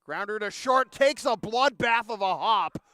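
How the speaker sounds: real excitement breaking into the broadcast; excited call. Real, not acted. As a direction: call the grounder as it takes a crazy hop. Live call of a wild hop.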